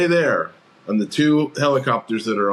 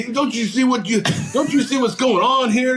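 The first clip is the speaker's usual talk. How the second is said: high mock whine mimicking the cop; mock exasperated cop; 11 semitones higher than usual. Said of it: "he's all".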